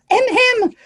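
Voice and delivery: high-pitched prim throat-clear; put-on witch's interjection; witch in pink. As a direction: squeak the two syllables, second held high. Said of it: "that ugly him".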